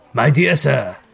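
lightly voiced Scrooge hailing someone; a mildly emphatic, friendly greeting; eager Scrooge. A narrator's reading. Scrooge hailing the old gentleman, lightly eager. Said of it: "said Scrooge".

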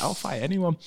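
high-pitched retold teenage bravado; mock defiance. Fifteen-year-old told he's not aggressive enough, mock defiant. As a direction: lightly act a young lad up for a fight.

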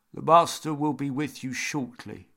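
a hint of a sepulchral butler; lightly voiced solemn old butler; creepy butler. A narrator's reading. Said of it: "he intoned".

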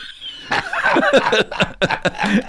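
bursts into a high laugh; big high laugh. Real, not acted. Laughing hard at his own story.

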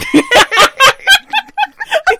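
high loud helpless laugh; real burst of laughter. Real, not acted. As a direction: break into a big real laugh.

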